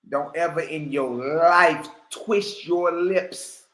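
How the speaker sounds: slow, drawn-out stern warning. Real, not acted. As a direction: spell out every word as a threat. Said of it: "slow emphatic warning".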